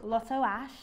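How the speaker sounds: Brummie attempt drifting towards Liverpudlian; Birmingham accent. Shown as a demonstration. Host showing off the guest's name, attempted Birmingham accent. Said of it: "do it in a Brom accent".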